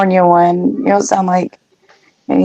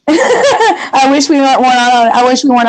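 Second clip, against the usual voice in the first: laughing; 6 semitones higher than usual. Real, not acted. Laughing through words.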